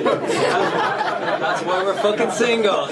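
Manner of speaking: slow, laughing loud punchline; laughing at the couples' silence. Real, not acted. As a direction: crack up on the joke. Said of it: "punchline with laugh".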